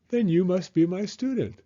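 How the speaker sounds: bird teacher. A narrator's reading. Little bird claims the dog as pupil, chirpy.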